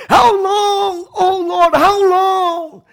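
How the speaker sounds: high, loud, pleading how long; anguished cry. Retold or imitated. Preacher voicing David crying out to the Lord. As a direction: wail it up toward heaven. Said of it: "David cries out".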